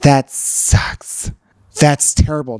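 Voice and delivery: low, breathed-out mock sympathy; acted aghast female friend, voice dropped; sympathizing girlfriend. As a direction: commiserate in hushed, drawn-out horror. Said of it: "Women, they're like".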